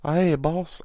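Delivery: imitating the new guy at work; coworker's voice. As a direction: put on his easygoing voice. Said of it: "This is his voice".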